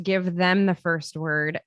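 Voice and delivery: usual speaking voice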